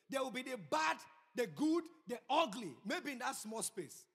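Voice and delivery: loud, high-pitched sermon peak; forceful preaching. Real, not acted. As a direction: drive each phrase home to the congregation.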